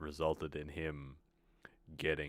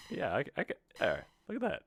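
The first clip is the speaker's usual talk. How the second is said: sudden delighted discovery, pitched way up; pleasantly surprised; 10 semitones higher, much faster than usual. Real, not acted. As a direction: voice jumps up high in surprise. Game option suddenly turns up.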